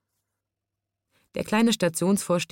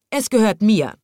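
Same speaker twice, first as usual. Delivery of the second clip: insistent claim; 5 semitones higher, 7 dB louder than usual. A narrator's reading. Woman claims the squirrel.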